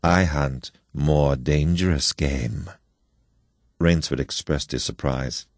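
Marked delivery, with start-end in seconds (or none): 0.0-2.7 slow, low, weighted reveal. Acted. General revealing his quarry to his guest, slow and weighty. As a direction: let each word sink in slowly. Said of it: "he said in the same slow tone".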